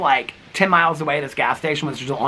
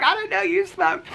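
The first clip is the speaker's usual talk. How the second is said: much higher, squeaky mock viewer voice; mock surprised viewers. Vlogger mimics viewers surprised she smoked, squeaky high mock voice. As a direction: play a shocked fan, pitched way up. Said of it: "People are like".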